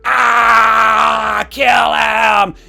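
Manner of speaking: long, loud sustained yell; mock mob roar. Democrats roaring at the conservative, mock bloodthirsty shout. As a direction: bellow it like a baying crowd.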